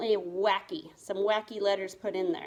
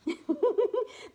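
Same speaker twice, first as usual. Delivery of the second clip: high giggle in mouse voice; squeaky laugh; 12 semitones higher than usual. Real, not acted.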